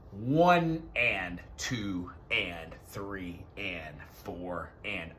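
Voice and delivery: very slow eighth-note count. Shown as a demonstration. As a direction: drag out "One and two and three and four and". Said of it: "like, an eighth note".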